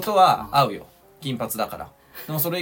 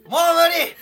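much higher, louder, half laughing half crying; laughing shout; 8 dB louder than usual. Real, not acted. Worn out by the teasing, laughing shout of defeat. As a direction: shout through laughter that you've had enough. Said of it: "大声出さすなよ".

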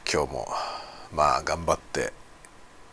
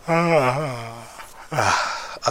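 drawn-out yawn; 7 semitones higher, much slower than usual. Real, not acted. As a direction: yawn through the sound. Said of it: "あくびが。".

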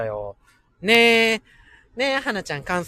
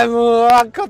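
dismayed groan; 6 semitones higher, 11 dB louder than usual. Real, not acted. Groaning loudly on recalling a heartbreak. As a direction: groan as the bad memory hits. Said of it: "テンション下がるようなこと思い出しちゃった".

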